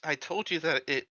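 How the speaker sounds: ordinary talk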